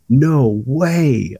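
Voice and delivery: high, drawn-out retold disbelief; amazed disbelief. Wrestler retelling hearing his nickname in a rap, amazed. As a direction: act out being stunned.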